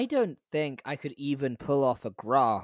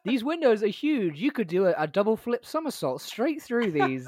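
higher, boastful rich-person voice; mock rich poster; 6 semitones higher, 3 dB louder than usual. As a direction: playfully mimic a show-off owner.

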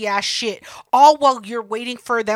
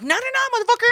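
mock outraged no; 13 semitones higher, much faster than usual. Refusing friend leaving again.

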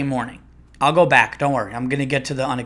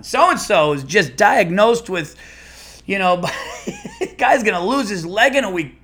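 loud, high pretend announcement breaking into laughter; mock radio host; 6 semitones higher, 5 dB louder than usual. Comic imagines next station's grim news, mock host voice.

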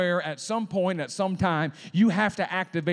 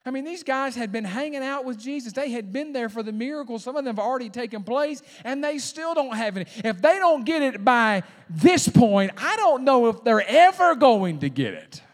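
high-pitched pulpit rant building louder; exasperated preaching; 7 semitones higher, 7 dB louder than usual. Real, not acted. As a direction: climb in volume to an incredulous finish.

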